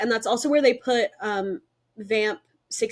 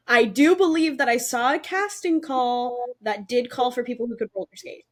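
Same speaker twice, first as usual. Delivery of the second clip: usual speaking voice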